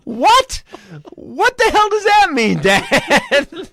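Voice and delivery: high, loud, slow disbelief; shocked outburst. Retold or imitated. Son shocked by his dad's story, high and loud. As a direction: shriek it in disbelief.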